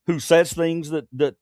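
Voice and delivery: normal talking voice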